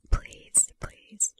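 usual speaking voice

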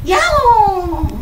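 loud, drawn-out cat yowl; angry cat meow. Acted.

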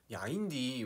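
loud, forceful Jeolla accent; strong Jeolla intonation. Shown as a demonstration. Showing fans strong Jeolla intonation, forceful Jeolla accent. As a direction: punch it out in Jeolla dialect. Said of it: "세요, 약간 억양이".

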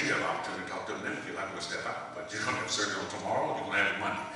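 low, soft doctor voice with no inflection; deadpan, unhurried medical calm; calm, cool doctor. Retold or imitated. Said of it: "As calm as he was".

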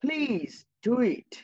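please do it stretched slowly and lifted; teacher slowly repeating the polite example sentence. Shown as a demonstration. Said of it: "এটা আরেকটা বাক্য".

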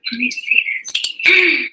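slow whispered aside. Real, not acted. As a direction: draw it out, unvoiced and slow. Checking stream setup.

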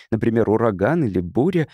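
ordinary delivery

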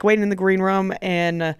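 ordinary talk, nothing marked

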